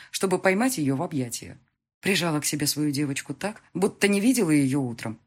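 plain reading voice

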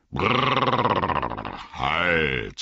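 a lightly voiced old man reining in; old man calling out. The old man stopping the running hobbits, lightly voiced call. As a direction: call brrr like halting a horse. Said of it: "rief der Alte".

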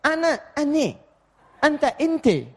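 high mock switch of "ana" to "ane" and "anta" to "inte"; mock Levantine accent; Levantine Arabic e-vowels. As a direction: playfully swap "A" for "E" like a Levantine speaker. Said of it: "kalau antum paksakan".

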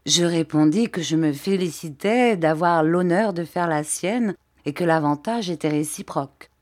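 ordinary delivery

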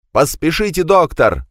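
breathless shout, pitched high; out of breath, calling for help; urgent panting report. Acted. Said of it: "задыхаясь, крикнул Адамберг".

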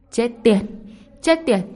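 loud, high-pitched, repeated swearing; alarmed cursing. A narrator's reading. Villain cursing as the fire rushes at him, alarmed. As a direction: curse in panic, pitch and volume up. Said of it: "ông ta chửi thề trong bụng".

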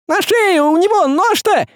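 very high screechy squeal; frantic squeal. A narrator's reading. Хохлик spots the knife on the sorcerer, frantic squealing. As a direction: squeal the alarm in a tiny high voice. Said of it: "заверещал Хохлик".

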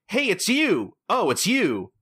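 high, swooping mock greeting; mock surprised recognition. Two acquaintances see each other in the club, mock-surprised. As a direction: imitate two people suddenly spotting each other.

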